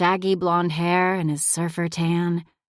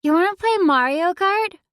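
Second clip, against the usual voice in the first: put-on much higher, louder eager kid voice; excited child; 11 semitones higher, 4 dB louder than usual. A narrator's reading. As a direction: burst in asking eagerly. Zoe burst back in.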